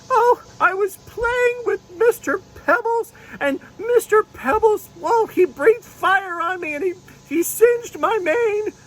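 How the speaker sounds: very high, slow, whimpering unicorn; little unicorn telling her woes, near tears; tearful unicorn puppet. Pretend. Unicorn puppet complaining to the pastor, high and tearful. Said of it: "She said".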